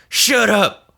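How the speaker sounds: high, loud mock snap; snapping farmer. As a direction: bark it at the wife. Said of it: "And he goes".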